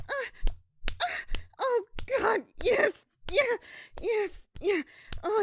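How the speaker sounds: high, loud cries of pleasure; ecstatic cries. Acted. Crying out in pleasure as her lover takes her. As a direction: let the pleasure break out.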